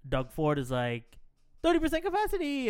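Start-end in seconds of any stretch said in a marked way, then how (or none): 1.6-2.7 announcement voice. Retold or imitated. Quoting the premier's announcement.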